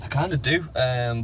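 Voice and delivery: normal talking voice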